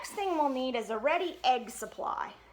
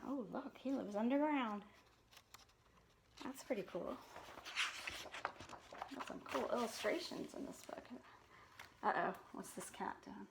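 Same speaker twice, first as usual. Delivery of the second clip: whisper; 13 dB quieter than usual. Real, not acted. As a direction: share a secret. Reader's whispered asides.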